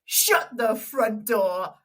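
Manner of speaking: slow, mock-stunned exclamation; imitated disbelief. Colleague learning she is on Radio One, stunned. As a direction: draw out each word in mock amazement. Retold amazed reaction to her job.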